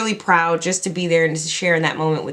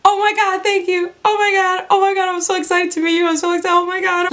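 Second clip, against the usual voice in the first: high, fast, breathless fangirl squeal; starstruck gushing; 10 semitones higher, faster than usual. Retold or imitated. Fan meeting her idol, squealing high and fast. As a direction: gush like you can't believe who's in front of you. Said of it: "all I could say was".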